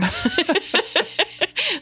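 bright high laugh; a real burst of laughter. Real, not acted. Laughing at a lost character.

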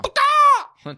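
high, loud screamed insult; shouted insult. Retold or imitated. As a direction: yell it at the stage. (insulto urlato)

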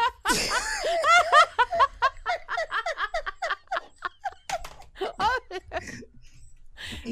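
long, high-pitched laughing fit; big laughing fit. Real, not acted. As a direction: crack up and keep laughing helplessly. Crowd and co-host crack up.

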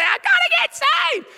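high squealed plea; mock cry to God. People at the altar cry out to God, high and squealed. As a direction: squeal it like a desperate convert. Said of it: "call out to God and say".

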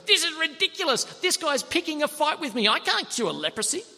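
an outraged king who cannot cure leprosy. Acted. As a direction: throw up your hands at an absurd demand. King tears his robes, outraged.

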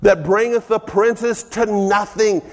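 high, ringing preacher's delivery of scripture; full-force preaching. Acted. Preacher proclaiming scripture to the congregation, full-force preaching.

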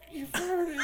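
high tearful accusation; squeaky imitation of weeping; mock crying. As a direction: whimper it like a sobbing girlfriend. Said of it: "You're crying over a fart".

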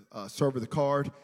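ordinary talk, nothing marked

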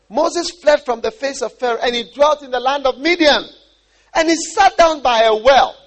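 high, loud, ringing proclamation; scripture reading. Acted. Preacher reading of Moses' flight to Midian, loud proclamation. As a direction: declaim the verse at full force.

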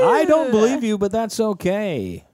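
high, teasing host voice; game show host. Pretend. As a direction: play the hammy emcee. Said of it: "game show host voice".

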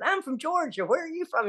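high Southern voice from the side of the mouth. Retold or imitated. Georgia girl answering in bookshop, high side-of-mouth Southern accent. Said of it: "talking out of the side of her mouth".

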